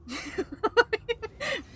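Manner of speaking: high-pitched giggle. Real, not acted. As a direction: let out a squeaky giggle. Laughing at own joke.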